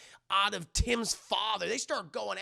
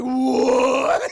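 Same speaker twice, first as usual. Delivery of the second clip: huge, high drawn-out "what"; mock shocked shout; 6 semitones higher, 15 dB louder than usual. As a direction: yell it in hammed-up disbelief. Voices everyone's shock at the reveal.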